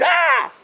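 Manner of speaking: short high-pitched mock scream; mock shriek. Lady splashed on her fresh sheitel, shrieking. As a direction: imitate a horrified shriek.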